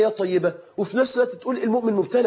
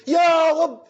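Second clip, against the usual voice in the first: desperate shout; 7 semitones higher than usual. Retold or imitated. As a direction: cry out to God with everything you have. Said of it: "وأنا أصرخ بعلو ما فيا".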